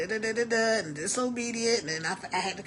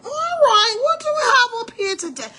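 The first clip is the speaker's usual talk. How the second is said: much higher, louder, bright call; excited greeting; 13 semitones higher, 7 dB louder than usual. Real, not acted. Host checking the live chat, excited high call. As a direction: perk up reading the chat.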